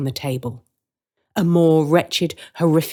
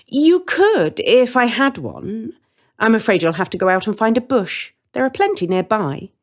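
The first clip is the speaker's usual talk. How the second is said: lightly voiced witch, higher and louder; amused witch with a teasing lilt; a hint of a sly witch; 7 semitones higher, 4 dB louder than usual.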